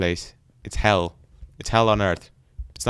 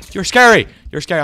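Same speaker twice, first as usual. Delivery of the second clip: high, loud accusation; rattled shout; 8 semitones higher, 10 dB louder than usual. Real, not acted. Player telling off a tall skeleton, rattled shout. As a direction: yell it at the monster.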